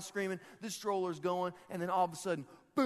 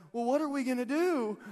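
high, louder, imitated fretting; mock panic; 8 semitones higher, 5 dB louder than usual. Mom to jogger at the lake, mock panic. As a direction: play a flustered mom, pitched up.

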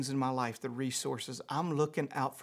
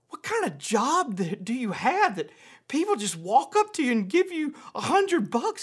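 amazed disbelief; 9 semitones higher, 5 dB louder than usual. Retold or imitated. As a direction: retell a kid's astonishment, pitch swooping up. Retold amazed question from son.

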